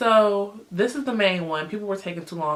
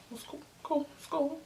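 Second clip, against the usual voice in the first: higher, much softer sarcastic brush-off; 7 semitones higher, 12 dB quieter than usual. Real, not acted. Hearing her friends knew, soft and sarcastic. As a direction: say it's fine, meaning it isn't. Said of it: "wasn't gonna tell me that".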